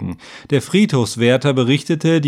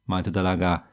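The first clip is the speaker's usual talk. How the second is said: plain reading voice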